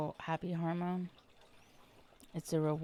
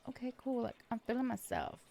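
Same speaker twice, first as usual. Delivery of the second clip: smug self-praise; 6 semitones higher than usual. Retold or imitated. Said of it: "And I was like".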